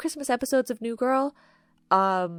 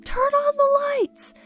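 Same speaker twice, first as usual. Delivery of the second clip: high, loud, drawn-out yell; screaming; 4 dB louder, slower than usual. Retold or imitated. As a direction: shout it slowly up at the dark houses. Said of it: "they start screaming".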